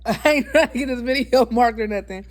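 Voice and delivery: laughing through the words; laughing while talking. Real, not acted. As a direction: giggle it out, embarrassed. Laughing aside after preaching.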